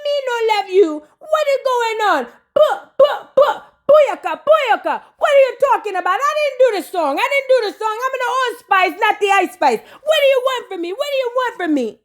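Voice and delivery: high, exasperated Jamaican patois; Jamaican accent. Retold or imitated. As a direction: protest innocence in a thick Jamaican accent. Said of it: "Spice was like".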